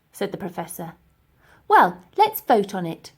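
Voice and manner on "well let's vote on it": loud, eager proposal; Elsie steaming. Acted. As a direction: push in with the idea. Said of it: "steamed in Elsie".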